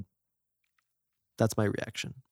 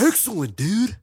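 a touch of high excited surfer dude; 10 semitones higher, 4 dB louder than usual. Retold or imitated. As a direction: cheer it like Bill and Ted. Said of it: "he talks like this".

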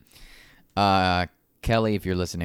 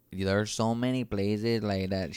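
imitated Spanish accent; 4 dB quieter, slower than usual. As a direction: mimic a co-host's Spanish accent, drawn out. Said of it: "brought the accent out more".

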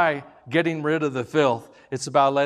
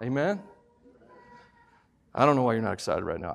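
ordinary talk

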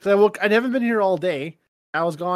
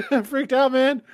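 laughing, pitched higher and a bit louder; nervous laughter; 7 semitones higher, 4 dB louder than usual. Real, not acted. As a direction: laugh off the fright. Laughing after being startled.

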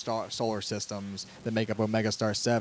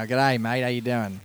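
put-on Aussie greeting, a bit louder; Australian accent; 5 dB louder, slower than usual. Shown as a demonstration. Host shows off his favorite accent, playful Australian. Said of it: "My favorite accent's the Australian one".

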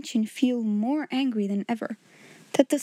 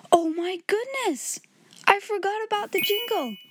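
sudden realization; 10 semitones higher than usual. Real, not acted. Host suddenly remembers jingle.

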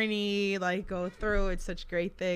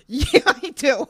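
loud, higher comeback through laughter; indignant retort; 5 semitones higher, 10 dB louder, slower than usual. Retold or imitated. Defending her thick accent in retelling, loud indignant retort. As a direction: insist on it loudly, amused.

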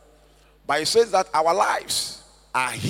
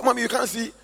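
put-on higher, pleading child's voice; dying girl pleading; 6 semitones higher, faster than usual. Dying girl pleads with her mother in a higher voice. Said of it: "And said".

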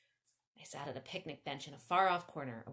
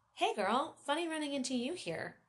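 high, playful greeting; joking; 8 semitones higher than usual. A narrator's reading. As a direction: joke as you greet her, pitched up. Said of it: "Donnie joked".

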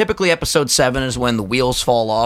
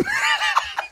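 high shrieking laugh; 5 dB quieter than usual. Real, not acted. Big laugh.

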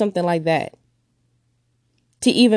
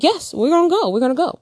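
placating father; 7 semitones higher, faster than usual. Retold or imitated. Father voiced in her story.

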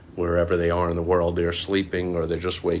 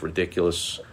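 ordinary talk

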